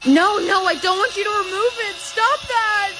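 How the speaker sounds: high, fast, frantic no; panicked protest. Acted. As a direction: back away in a panic. Refusing the pimple removal.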